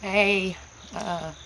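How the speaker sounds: usual speaking voice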